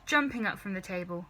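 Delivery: usual speaking voice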